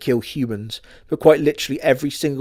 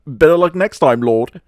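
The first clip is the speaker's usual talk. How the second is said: taunting; 5 semitones higher, 6 dB louder than usual. Retold or imitated. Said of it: "by going".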